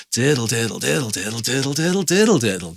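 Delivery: rapid rolling "diddle" chain, excited; very fast. Shown as a demonstration. Teacher says it very fast, sounding really excited. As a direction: rattle off "diddle" quickly, each "did" stressed. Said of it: "Say it very fast".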